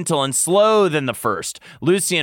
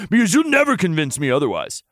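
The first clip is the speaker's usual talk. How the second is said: fast, higher insistence; growled; 4 semitones higher, faster than usual. A narrator's reading. Lucian scolds the lover, growled. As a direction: insist in a quick rush. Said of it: "Lucian growled".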